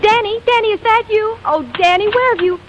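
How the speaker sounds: eager calling. Acted. Leading lady calling for her missing partner, eager and hopeful. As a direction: call out, thinking he's finally here. Vicky thinks missing Danny arrived.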